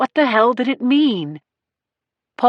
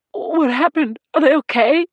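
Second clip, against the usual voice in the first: higher, choked panic; 6 semitones higher than usual. A narrator's reading. Frantic husband asking about his family, choked. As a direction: force the words through a tight throat. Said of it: "Marone choked out".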